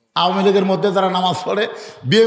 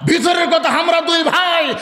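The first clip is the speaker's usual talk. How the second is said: high, sustained preacher's shout; ringing high declamation; full-force preaching; 11 semitones higher than usual. Real, not acted. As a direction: drive the point home to the crowd.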